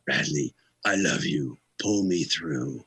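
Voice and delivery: low, slow heartfelt rock-star imitation. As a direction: plead slowly as the singer mid-hug. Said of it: "gives me a big hug and says".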